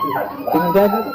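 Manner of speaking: high, slow put-on Texas drawl; mock Texan drawl. Comedian imitating a Texan asking the question, mock Texan drawl. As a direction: stretch it out like a Texan cowboy.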